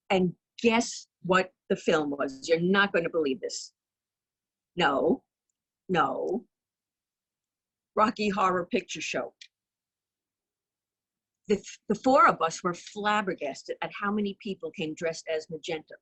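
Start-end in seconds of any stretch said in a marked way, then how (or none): none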